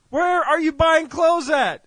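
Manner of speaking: slow, high, loud shouted demand; furious caller. Acted. Caller to shopper stuck among soup, acted furious demand. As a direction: shout each word in mock fury.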